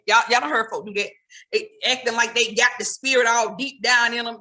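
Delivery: normal talking voice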